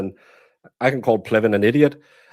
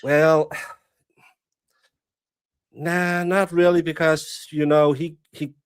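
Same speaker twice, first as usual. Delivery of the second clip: mock Fyn accent; 4 semitones higher than usual. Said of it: "you'll use that accent".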